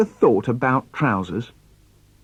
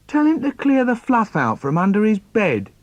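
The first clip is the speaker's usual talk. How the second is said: lightly voiced bossy brother, higher pitch; a hint of a tell-tale brother; tattling brother; 7 semitones higher than usual. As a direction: complain to a parent, pitched up. Acted brother telling tales.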